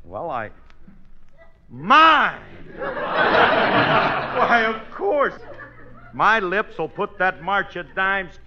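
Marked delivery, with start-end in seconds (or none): 1.7-5.3 proud exclamation. Acted. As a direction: shout it like the obvious answer. Proud claim to raise money.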